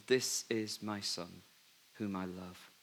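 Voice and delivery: a hint of God speaking from the cloud; lightly voiced God, solemn and hushed. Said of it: "a voice from the cl-, cloud comes".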